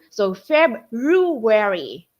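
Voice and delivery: February said slowly with a clear R, Feb-ru-ary; slow down and sound the R in February; careful R sound. Shown as a demonstration. Showing teachers the R sound, slow February. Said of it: "you have the R sound".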